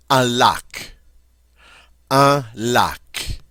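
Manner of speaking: teacher modelling "lac"; model French word. Shown as a demonstration. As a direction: say "Un lac" for students to repeat. Teacher models vocabulary words.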